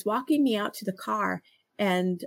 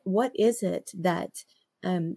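ordinary talk

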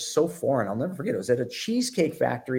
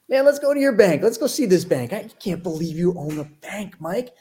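high, excited mock amazement, louder at first; retold disbelief; 8 semitones higher, 4 dB louder than usual. Razzing Mike over lunch about owning a bank, mock amazement. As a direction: tease a friend with over-the-top incredulity.

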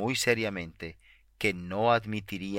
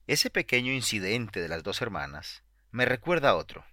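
ordinary delivery

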